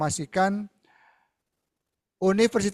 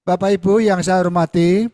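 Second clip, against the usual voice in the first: much louder, projecting clearly; speaking up so all can hear; 11 dB louder than usual. Real, not acted. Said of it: "saya keraskan sekarang".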